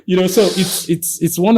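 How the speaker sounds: amused high laugh through words. Real, not acted. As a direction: chuckle at your own story. Laughs at himself.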